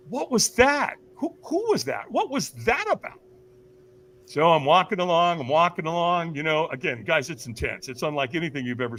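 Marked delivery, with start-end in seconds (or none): 0.1-3.2 high-pitched, baffled acted questions; bewildered. Speaker left alone after stranger disappears, bewildered. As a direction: stammer it out, mystified.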